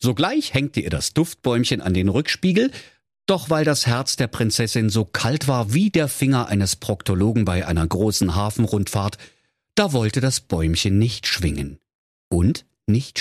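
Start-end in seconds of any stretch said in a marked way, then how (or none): none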